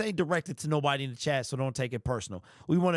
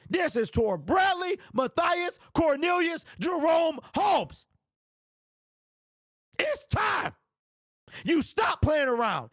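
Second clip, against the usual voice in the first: furious shouted rant; 12 semitones higher than usual. Real, not acted. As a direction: rant at the top of your voice, boiling over. Shouting Brad Holmes' full name.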